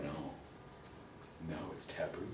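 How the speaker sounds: very low, barely audible reluctance; mock timid. Students refusing to say the word, mock timid.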